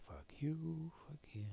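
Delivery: hissed whispered curse. Real, not acted. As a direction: fluister het venijnig. Frustrated gamer cursing at screen.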